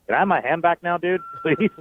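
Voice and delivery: high-pitched pleading, half laughing; retold meek plea. Radio guest recalls asking a giant player for his hand back, meek plea. Retells timid handshake request.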